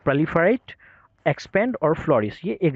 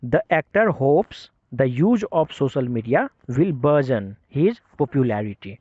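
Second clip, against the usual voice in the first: slow, careful English reading; slow example sentence; much slower than usual. Shown as a demonstration. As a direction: read the example sentence out slowly. Repeats example sentence slowly. (धीरे धीरे पढ़ा गया उदाहरण वाक्य)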